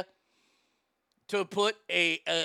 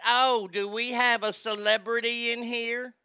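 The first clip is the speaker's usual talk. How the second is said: imitated Southern lady, raised pitch; slow Southern drawl; 6 semitones higher, 3 dB louder than usual. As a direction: mimic a nosy Southern lady's drawl. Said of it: "'cause that's how she talked".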